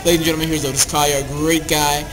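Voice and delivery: ordinary delivery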